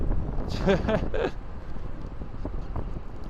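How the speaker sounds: breathy laugh. Real, not acted. Laughs after story.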